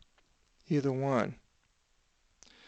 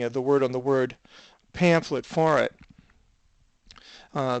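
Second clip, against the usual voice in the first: usual speaking voice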